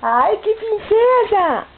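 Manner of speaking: delighted. Real, not acted. Delighted at granddaughter.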